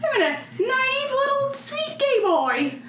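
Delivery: high, silly voice playing a clueless naive boy; mock naive Jim. Cohost mocks Jim as a clueless sweet boy, high and silly. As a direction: pitch it way up and play dumb.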